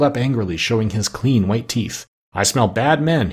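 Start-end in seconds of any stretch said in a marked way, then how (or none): none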